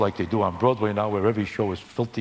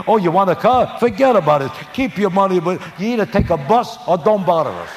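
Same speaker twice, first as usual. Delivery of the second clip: loud, higher, dismissive casino voice; mock loud brush-off; 6 semitones higher, 6 dB louder than usual. Casino staff brushing off the gamblers, loud and mocking. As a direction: bark it out like a snooty casino host. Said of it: "Could you imagine them saying".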